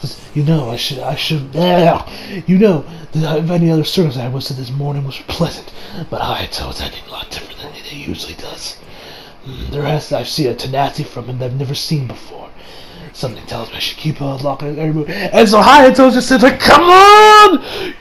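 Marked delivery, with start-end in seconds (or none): 0.3-15.1 low, hushed villain's inner voice; a brooding killer's murmured monologue, much softer; Kira's inner narration. Retold or imitated. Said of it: "we then get some narrative from Kira". 16.6-17.9 high, loud, slow frantic shout; desperate boy shrieking at a hidden enemy; frantic yell. Retold or imitated. Hayato yelling at hidden Kira.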